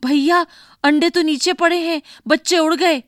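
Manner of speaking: little sister calls loudly to her brother about the eggs. A narrator's reading. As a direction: burst in shouting the news to brother. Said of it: "जोर से बोली".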